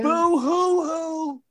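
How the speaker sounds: slow mock boo-hoo sobbing; fake crying. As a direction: whine it like a fake crybaby. Mocking Zack Ryder's tears.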